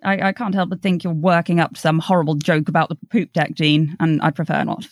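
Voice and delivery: ordinary talk, nothing marked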